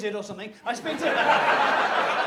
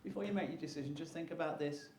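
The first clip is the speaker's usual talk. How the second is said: soft persuasion; 5 semitones lower, 14 dB quieter than usual. Retold or imitated. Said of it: "she says".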